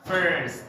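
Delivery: punched "First" with emphasized R; stressed R. Shown as a demonstration. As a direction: hit the R in "First". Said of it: "they say first".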